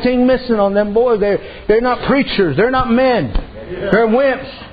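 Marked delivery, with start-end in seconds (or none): none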